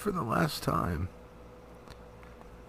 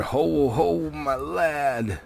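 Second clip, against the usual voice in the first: a light hint of jeering goblin laughter; ugly goblin laugh; 4 semitones higher, much slower than usual. Goblins jeer at the captured dwarves, lightly voiced mocking laugh. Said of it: "the ugly laughter of their".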